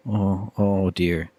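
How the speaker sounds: hammed-up whimper of panic; mock scared.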